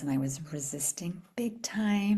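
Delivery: hushed confiding whisper; whispering the confession to listeners. Real, not acted. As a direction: lean in and whisper it. Whispering about resisting.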